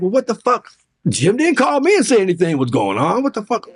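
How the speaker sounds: high-pitched indignant disbelief; worked-up "what the fuck"; retold inner outrage. Retells his inner outrage at colleague.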